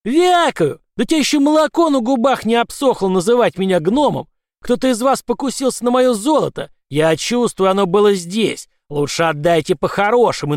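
high, loud raging shout; furious leprechaun. Acted. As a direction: rage at the hunter.

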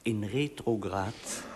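slow, hushed confiding tone; quiet secretive murmur; softly. Acted. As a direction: lean in and say it softly. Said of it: "zei hij zachtjes".

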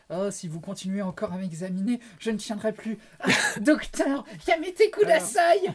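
hammed-up swooning patient; moaning manga heroine.

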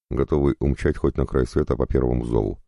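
usual speaking voice

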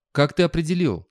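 plain reading voice

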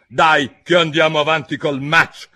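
loud, high royal order; bossy queen. A narrator's reading. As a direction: call it out as a domineering queen. Queen voiced ordering Alice onward.

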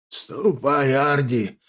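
hoarse, strained grandfather; croaking old man. A narrator's reading. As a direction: croak it out between coughs. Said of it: "прокряхтел дедушка, опять заходясь в кашля". (хриплый кряхтящий старик)

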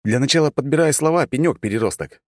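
high-pitched irritated retort; flaring up. A narrator's reading. Hero flaring up at god feigning innocence, hot retort. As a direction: snap back with pitch jumping up. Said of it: "вспылил я".

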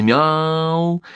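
long, high, piercing meow; plaintive cat meow. A narrator's reading. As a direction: wail it like a lost cat. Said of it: "долгое, жалобное, пронзительное".

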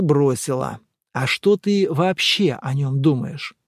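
plain reading voice

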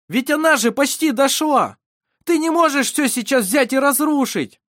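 high, loud cry of despair; desperate shout. A narrator's reading. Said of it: "Вассар кричал в отчаянии". (отчаянный крик)

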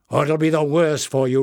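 loud angry threat on worse; roar. A narrator's reading. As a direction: bark out the threat. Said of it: "roared Bada".

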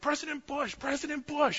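high-pitched imitation of excited kids; mock kids' screaming. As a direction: call out like kids at a rally. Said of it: "his kids are screaming".

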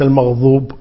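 المغضوب with a "dh" sound; zha for dad. Shown as a demonstration. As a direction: show المغضوب misread with ظ. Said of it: "وليس".

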